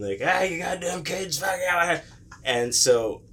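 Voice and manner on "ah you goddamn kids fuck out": high-pitched angry neighbor imitation; mock mad yell. Mad driver at the prank kids, high mock scolding. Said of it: "people get really mad".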